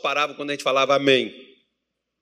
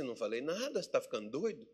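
resposta encenada baixinha; 2 semitones lower, 13 dB quieter than usual. Retold or imitated. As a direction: play it down, hushed and incredulous. Her retort in acted exchange. (negação baixinha, encenada)

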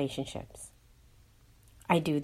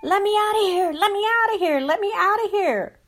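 high, loud desperate cry to be let out; crying out as Clacy; 12 semitones higher, 7 dB louder than usual. Acted. Clacy begging to be freed, crying out loud. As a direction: shout it like someone trapped in the dark. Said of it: "cried Clacy".

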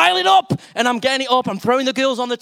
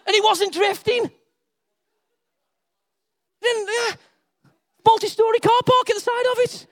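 getting excited; 8 semitones higher than usual. Real, not acted. Preacher carried away telling about the kids' igloo, excited. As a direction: build up more and more thrilled. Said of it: "getting a bit excited".

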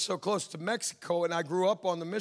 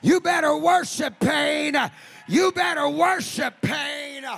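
shouted, higher sermon cry over the organ; full force preaching; 8 semitones higher, 6 dB louder than usual. Acted. Preacher urging the congregation, full force preaching. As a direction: preach it out loud, rising to a call.

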